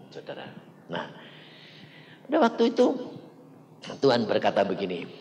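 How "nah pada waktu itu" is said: ordinary delivery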